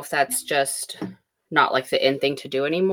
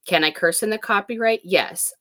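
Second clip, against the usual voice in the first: ordinary talk, nothing marked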